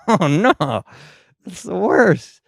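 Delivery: high-pitched horrified reaction; mock dismay. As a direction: wail it in comic dread. Acted dismay at flat cats.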